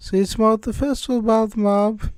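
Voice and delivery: high, fast British lady voice; chirpy British farewell; British accent. Acted. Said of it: "can't shake this British accent".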